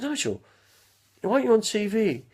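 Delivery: pitched well up, playful and teasing; high teasing question. Retold or imitated. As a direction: ask it high and cheeky, like an old friend. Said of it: "I said".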